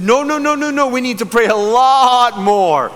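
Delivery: mock-alarmed protest, pitch jumping up; flustered refusal. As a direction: backpedal in a high, hurried voice. Acts out his own alarmed refusal.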